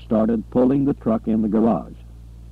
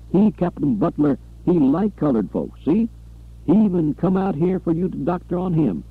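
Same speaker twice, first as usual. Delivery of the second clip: higher, anxious pleading servant; a pitched-up, rushed plea to end it; frightened pleading; 6 semitones higher than usual. Acted. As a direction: beg the doctor nervously. Frightened Buford pleading, sweating.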